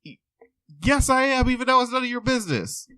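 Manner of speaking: high, huffy put-on voice; indignant man. Man in the stall telling the patient off, high and huffy. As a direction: snap back, offended. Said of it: "And he said".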